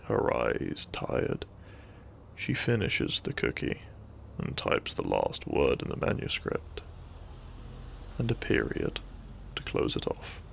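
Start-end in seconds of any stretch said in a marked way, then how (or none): none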